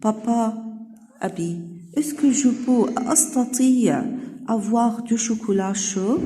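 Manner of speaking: put-on a girl sweetly asking her father for hot chocolate; Clara pleading. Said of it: "أبوها بتقول له".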